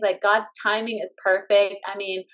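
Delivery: normal talking voice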